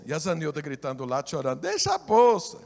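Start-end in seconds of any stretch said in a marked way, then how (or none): 1.6-2.6 loud, higher cry of a terrified wife; frightened plea. Retold or imitated. Crying wife shouting at her husband to leave the bag. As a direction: beg him to drop it, half shouting.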